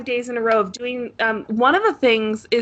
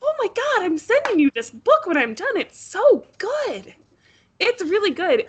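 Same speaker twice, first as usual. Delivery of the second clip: gushing, much higher pitch; excited; 9 semitones higher than usual. Real, not acted. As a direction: rave about it, thrilled. Gushing about a favourite book.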